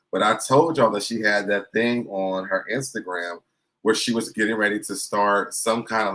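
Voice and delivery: ordinary talk, nothing marked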